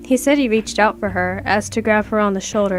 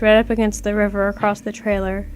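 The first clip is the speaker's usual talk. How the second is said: ordinary delivery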